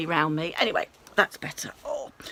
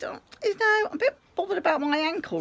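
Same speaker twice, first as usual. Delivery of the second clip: much higher, sweetly asking; polite request; 10 semitones higher than usual. Retold or imitated. Retelling her polite request.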